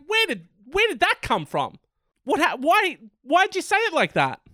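high-pitched exasperated questioning; mock bafflement. Host challenging people over the accent, exasperated. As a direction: demand it in high-pitched disbelief.

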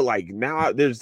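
ordinary talk, nothing marked

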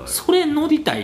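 put-on high, eager girlfriend voice. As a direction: ask like an excited girlfriend. Girlfriend's eager request retold.

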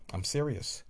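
ordinary delivery

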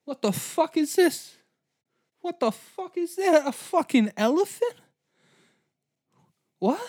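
high, bewildered squeal; stunned Greek soldier seeing an elephant; baffled Greeks. Retold or imitated. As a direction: squeak out total confusion at the beast. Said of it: "They're like".

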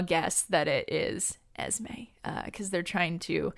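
hushed, confiding whisper; conspiratorial whispered aside. Real, not acted. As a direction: lean in and whisper the guess. Confiding a spoiler guess.

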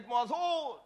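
high, loud defiant insistence; insistent shout. Acted. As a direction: shout it back in argument. Acted quarrel over the meeting.

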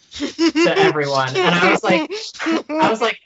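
high-pitched laughing. Real, not acted. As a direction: laugh through the words. Laughing while telling a story.

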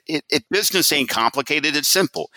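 high-pitched imitation of a Southern executive; mimicked Southern businessman; Southern accent. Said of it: "in his Southern accent".

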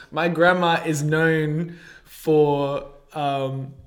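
slow, still shaky from laughing; regaining composure after a laughing fit; after laughing hard. Real, not acted. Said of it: "sorry, let me compose myself".

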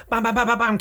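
high, punchy bams like gunfire; mock gunshots. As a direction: fire off each bam as a shot. Retelling John Wick gunfight.

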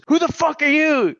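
high-pitched mock scream of an enraged dad; retold scream. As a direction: imitate a furious challenge, voice jumping way up. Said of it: "He screams at her".

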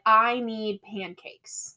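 very slow, each word stretched out; deliberate demand. Retold or imitated. Bailey randomly wants food at night, slow deliberate declaration. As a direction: imitate a solemn craving, word by word.

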